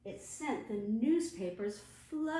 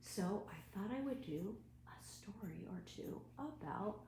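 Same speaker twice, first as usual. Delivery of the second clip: whispering; 9 dB quieter than usual. Real, not acted. Librarian starts story time.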